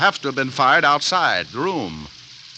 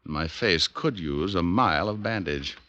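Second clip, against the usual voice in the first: story narration; 2 semitones lower than usual. A narrator's reading. Detective narrates over music.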